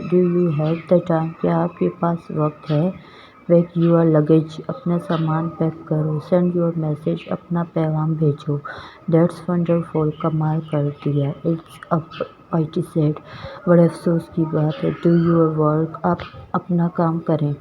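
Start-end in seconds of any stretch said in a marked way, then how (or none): none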